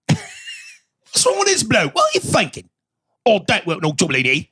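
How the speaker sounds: high-pitched, hammed-up British accent; mock English accent. Podcast guest ridicules the accent killing him, hammed-up English accent. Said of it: "I'm just this accent".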